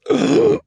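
loud, high, thrilled gasp; over-the-top gasp of delight; excited gasp. Acted. Esme imagining orphans in pinstripes, excited gasp.

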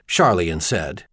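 ordinary delivery

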